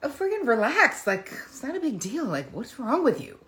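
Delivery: high, scoffing brush-off, dropping to a whisper by the end; dismissive brush-off. Retold or imitated. Passive-aggressive person dismissing the angry other person, scoffing. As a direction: wave their anger away like it's nothing. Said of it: "and then you get to go".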